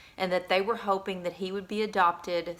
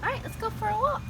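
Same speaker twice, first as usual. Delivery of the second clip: much higher, sweet pet-talk voice; talking to the dog; 13 semitones higher than usual. Real, not acted. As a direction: coo it to a scared puppy. Owner talks to shy dog.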